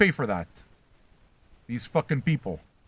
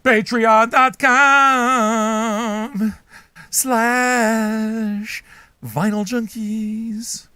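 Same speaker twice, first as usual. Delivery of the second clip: slow, booming, higher announcer drawl; drawn-out plug; 7 semitones higher, 6 dB louder, much slower than usual. Acted. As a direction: stretch every word, very slow, high and loud.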